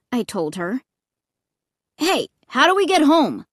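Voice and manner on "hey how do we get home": demanding. A narrator's reading. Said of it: "Luke suddenly demanded".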